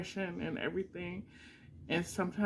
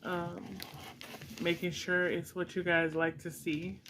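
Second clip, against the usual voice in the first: usual speaking voice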